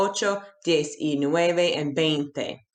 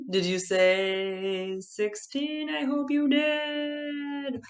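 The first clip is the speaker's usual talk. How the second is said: slow, drawn-out hopeful question; 7 semitones higher, much slower than usual. Pretend. Teacher hoping the class got sixteen, slow and coaxing.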